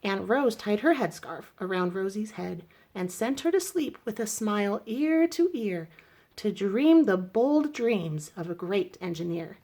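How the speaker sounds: ordinary delivery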